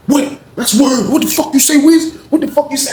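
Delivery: high indignant challenge; OG confronting. Retold or imitated. The OG confronting the teller, high and indignant.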